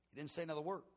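normal talking voice

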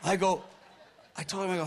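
ordinary talk

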